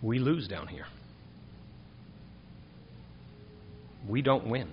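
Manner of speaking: softly, with long heavy pauses; quiet grave statement. Acted. Preacher to congregation, quiet and grave.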